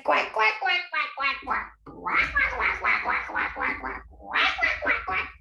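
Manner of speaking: rapid imitation duck quacks; string of cartoon duck calls, jumping in pitch; quacking ducky. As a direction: quack away in a squawky put-on voice. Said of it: "Ducky said".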